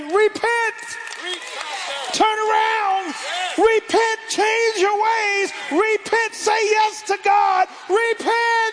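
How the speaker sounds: very high, drawn-out preacher's shout; crying out. Real, not acted. Preacher calling sinners to repent, crying out. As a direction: cry it out at the top of your range. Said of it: "I'm crying out to you today".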